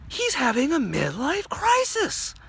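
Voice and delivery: very high, rising alarmed realization; shocked officer. Acted. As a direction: build from stunned discovery to loud panicked announcement. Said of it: "the officer's eyes widens in shock".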